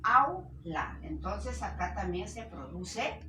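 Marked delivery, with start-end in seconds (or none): 0.0-1.0 AU joined as one loud, high syllable, then LA apart; syllable division. Shown as a demonstration. Teacher showing vowels that must unite, syllable by syllable. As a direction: say AU as one glide, pause, then LA. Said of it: "Tienen que unirse".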